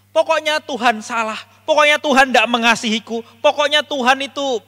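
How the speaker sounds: loud, high mock protest; stubborn angry blaming. Believer who won't ask blames God, stubborn and angry. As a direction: act out someone refusing to listen, insisting loudly.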